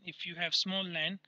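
usual speaking voice

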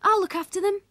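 put-on high, loud eager boy; young Willie's voice. A narrator's reading. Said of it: "Willie said".

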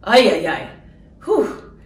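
barely getting words out, overwhelmed; catching her breath, overwhelmed; overwhelmed, can't speak. Real, not acted. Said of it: "I can't even speak".

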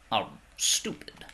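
slow whispered insult; whispered verdict. Pretend. Mock pronouncement.

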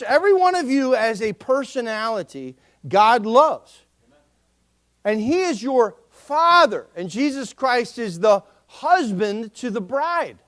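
usual speaking voice

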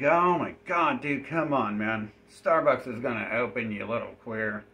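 loud, fed-up complaint at the NPC; loud annoyed rant to a stuck NPC; exasperated yelling. Retold or imitated. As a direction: yell at him like he's wasting your time. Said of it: "And I'm like".

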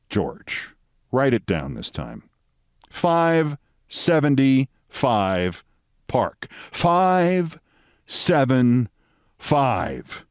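very slow, each number drawn out; laboured patience. Retold or imitated. Williams dictating the address to George, laboured patience.

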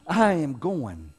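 words slowed, broken by a real laugh. Real, not acted. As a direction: laugh through it, slowly. Laughing at himself mid-sentence.

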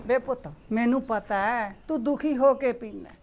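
soft, low consoling words; calm her son down gently; softly comforting mother; soothing mother. Acted.

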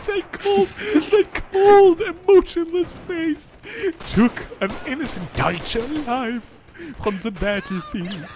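mock drama. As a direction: overplay the tragedy for laughs.

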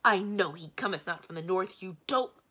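loud, exasperated retort; irritated snap. A narrator's reading. Magician cuts off his monster, snapping angrily. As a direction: snap it back impatiently. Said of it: "snapped the great man".

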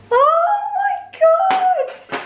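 high, delighted squeal; excited squeal. Real, not acted. Reacting to festive breakfast surprise.

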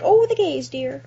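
high, mock-prissy bigoted old lady. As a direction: tut it like a disapproving old lady. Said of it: "She's probably like".

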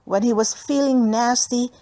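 plain reading voice

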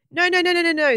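high, loud, rapid-fire string of no's; panicked. A narrator's reading. Egbert to the balloon man twisting balloons, panicked. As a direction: blurt it in alarm to stop him.

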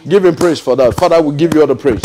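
low-pitched, repeated calls to praise; praise prayer. Real, not acted. Preacher urging congregation to praise God, low-pitched prayerful praise. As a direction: drop into a low, steady chant-like prayer of thanks.

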